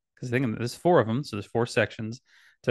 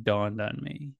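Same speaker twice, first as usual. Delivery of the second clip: ordinary delivery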